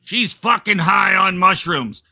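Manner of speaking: a tired husband wanting money back; mock blunt husband; deadpan husband. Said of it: "here's the whole sentence".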